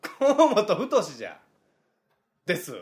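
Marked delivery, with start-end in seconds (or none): 0.0-1.4 slow, high, loud name correction; tsukkomi retort. Acted. Comedian correcting a silly name in greeting, loud tsukkomi. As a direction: belt out the name slowly.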